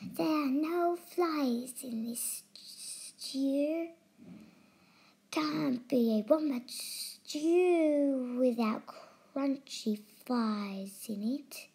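slow, breathy lizard hiss; slow hissing lizard whisper. Acted. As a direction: hiss it out slowly. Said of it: "he hissed".

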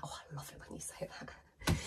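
breathy whispered imitation of her husband; imitating husband's whisper. Imitating her Brazilian husband, who loves her British tosser, in a whisper. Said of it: "He's like".

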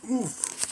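a quick pained oof; high pained grunt. Acted. Trap pried off the ankle.

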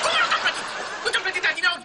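very high, incredulous protest; outraged. Acted. Mayor disputing payment with the hired victim, outraged.

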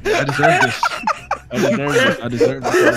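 laughing high, breaking up. Real, not acted. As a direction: giggle through the words. Laughing at own death.

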